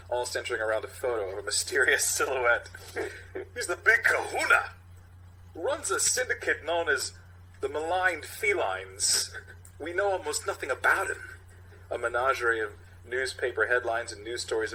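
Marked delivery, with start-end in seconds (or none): 3.5-11.2 Walken impression. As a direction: drag each word out like Walken. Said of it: "Captain Ronnie".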